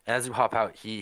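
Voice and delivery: normal talking voice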